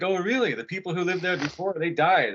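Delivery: high, emphatic reveal; imitated stranger. Stranger telling the new owner of deaths, high imitated voice. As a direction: pitch it up as the stranger breaking news.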